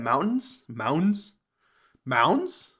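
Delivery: Mountains said with the T dropped, Mounds; mountains with a silent T. Shown as a demonstration. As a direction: swallow the T so mountains sounds like Mounds. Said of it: "do people not pronounce the T in mountains?".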